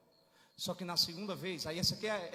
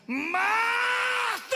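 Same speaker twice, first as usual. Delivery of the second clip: huge, high, drawn-out bellow; thunderous call, stretched long; raised-voice shout; 11 dB louder than usual. Shown as a demonstration. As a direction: cry out Marta at full voice. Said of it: "Aí ele sobe o tom e diz assim".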